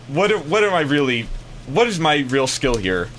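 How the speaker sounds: ordinary delivery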